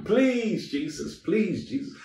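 imitating a desperate plea, high on each please; pleading cry. Man on crutches begging Jesus in the prayer line, imitated pleading. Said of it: "he was crying".

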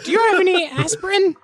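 high-pitched kobold. Acted. Kobold worn out by garbled questions, high voice. Kobold confused by bad Draconic.

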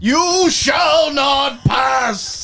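booming, drawn-out, high theatrical yell; hammed-up shout. Podcast guest bursts into a film line, hammed-up shout. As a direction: bellow it in mock-epic defiance.

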